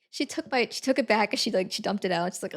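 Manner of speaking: usual speaking voice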